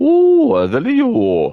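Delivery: "Ooh, I love you" squealed very high and loud; mock swooning fan. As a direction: squeal at the star like a besotted fan. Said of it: "they're like".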